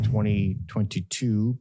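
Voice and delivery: ordinary delivery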